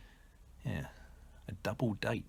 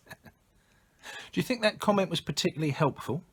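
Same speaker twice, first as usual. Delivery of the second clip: raised, pointed question; pointed reproach; 9 semitones higher than usual. Acted. Victim scolds speaker over a tactless comment, pointed reproach. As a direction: take them to task in a higher voice. Acted retold reproach from listener.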